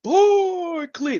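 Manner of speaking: big drawn-out ring announcer call; boxing ring announcer. Retold or imitated. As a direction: belt out the fighter's name like a ring announcer. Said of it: "I introduced Iran the Blade Barkley".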